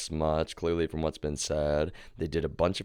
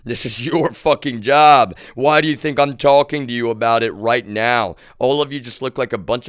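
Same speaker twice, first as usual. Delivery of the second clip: angry retort; 6 semitones higher, 10 dB louder than usual. Retold or imitated. Telling a coworker it's his job, loud and furious. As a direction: blast him for dodging his job.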